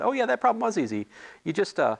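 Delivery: offhand friend brushing it off; breezy Somber Paul. Retold or imitated. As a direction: toss it off like it was nothing. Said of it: "Somber Paul said".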